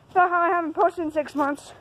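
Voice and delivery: normal talking voice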